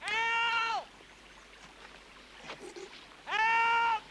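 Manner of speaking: high, long-held calls; shouting for help. Acted. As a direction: call out to guide the rescuer. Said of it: "Keep shouting".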